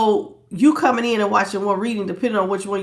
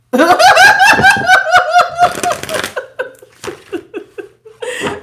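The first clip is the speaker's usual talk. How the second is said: loud high cackle; loud, helpless high-pitched laughing fit; burst of laughter; 8 dB louder than usual. Real, not acted. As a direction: explode laughing, high and long. Cracks up after monotone demo.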